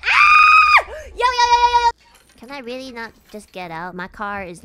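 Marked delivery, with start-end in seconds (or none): 0.1-0.9 very high, loud shriek; piercingly high scream of fright; terrified scream. Real, not acted. Jump scare. 0.9-1.9 high, loud string of yelps; frantic, repeated alarm cry; panicked shouting. Real, not acted. Panicking after scare.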